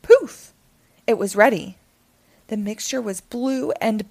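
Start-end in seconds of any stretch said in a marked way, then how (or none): 0.0-0.5 a sudden magic pop, big and bright; loud poof. A narrator's reading. Narrator bursting out the magic poof loudly.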